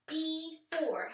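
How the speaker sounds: BEFORE said as two clapped beats, be and for; clapped out word. Shown as a demonstration. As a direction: clap out BEFORE beat by beat. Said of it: "Let's clap it out".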